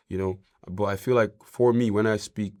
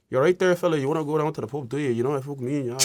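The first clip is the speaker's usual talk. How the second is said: higher, lively Irish pub banter; thick Irish accent; 6 semitones higher, faster than usual. Shown as a demonstration. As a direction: invite a mate in thick Irish. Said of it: "there's thick Irish words".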